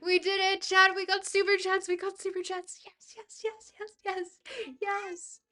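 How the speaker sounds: high-pitched, elated cheering; excited celebration. Real, not acted. Streamer thanks chat for super chats, excited celebration. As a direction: celebrate a win in high, eager bursts.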